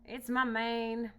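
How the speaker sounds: slow, loud lament; mournful lion. Acted. Lion lamenting his messy mane, slow and loud. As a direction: moan about the mane. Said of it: "said the lion".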